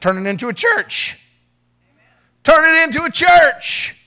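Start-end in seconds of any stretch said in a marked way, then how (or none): 2.4-4.0 emphatic repeat. Acted. Pastor repeating his advice to Sean, belted emphatically. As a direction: Turn it into a church belted higher and louder, slowly.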